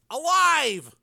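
ALIVE cried out high and emphatic; relieved yell. A narrator's reading. Frank telling his brother, relieved yell. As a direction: shout "Alive" as glad news. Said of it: "he yelled out in relief".

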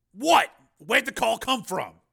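high, loud, disbelieving question; startled demand. A narrator's reading. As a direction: react sharply and demand the answer. Marshal demands the trace result.

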